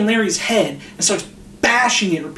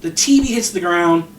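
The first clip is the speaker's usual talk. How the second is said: usual speaking voice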